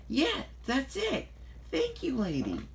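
high, drawn-out excited outburst; elated. A narrator's reading. Thomas has an idea thanks to Lady, elated. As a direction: burst out high with sudden inspiration.

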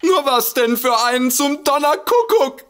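exasperated cry; exasperated outburst. Acted. Impatient exasperated demand.

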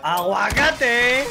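loud, very slow drawn-out exclamation; panicking gamer. Real, not acted. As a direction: stretch the word out like a silly yell. Sudden silly exclamation under fire.

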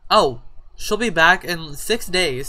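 normal talking voice

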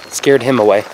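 usual speaking voice

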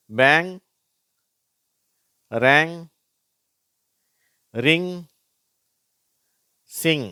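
"பேங், ரேங், ரிங், சிங்" high, each ending in ng; NG sound demo. Shown as a demonstration. Teacher reading NG examples, "பேங், ரேங், ரிங், சிங்" high and separate. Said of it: "இங்கு அப்படிங்கிற உச்சரிப்பு கிடைக்குது".

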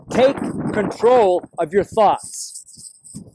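very slow, spaced-out words; weighty, drawn-out retelling of God's words; deliberate command. Retold or imitated. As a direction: draw out each word of the command with pauses. Dwelling on God's clear command.

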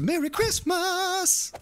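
very high, drawn-out jingle voice; high, slow novelty greeting; sound-machine voice. Pretend. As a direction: squeeze it out slowly like a toy sound button. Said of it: "little button I can just push".

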